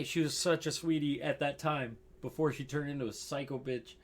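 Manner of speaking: ordinary talk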